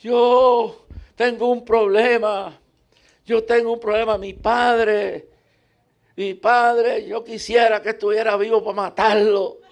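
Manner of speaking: put-on high, loud, slow anguished old woman; old woman on the boat pouring out hurt, high and loud; old woman crying out. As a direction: howl out her pain as the old lady. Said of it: "empieza a gritar".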